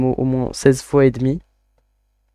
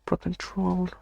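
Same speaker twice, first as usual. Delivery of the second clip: put-on a light, jokey English accent on the words; mock English accent; 5 semitones higher, 7 dB quieter, slower than usual. Streamer jokingly reading the controller name to viewers, mock English accent. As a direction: say it like a Frenchman putting on English. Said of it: "Avec mon super accent anglais".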